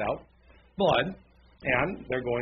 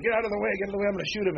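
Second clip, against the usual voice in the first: lightly acted urgent warning, higher pitch; urgent rifleman; 7 semitones higher, much faster than usual. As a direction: imitate a marine clearing people from his shot. Rifleman in a retold movie scene.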